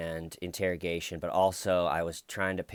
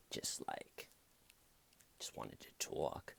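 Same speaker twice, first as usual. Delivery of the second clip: whispered surfer bro; 9 semitones lower, 16 dB quieter than usual. Retold or imitated. Imitating suspect, whispered.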